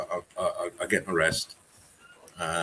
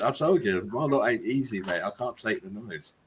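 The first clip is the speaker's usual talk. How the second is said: ordinary talk, nothing marked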